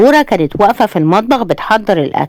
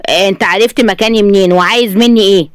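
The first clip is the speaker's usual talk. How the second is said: ordinary delivery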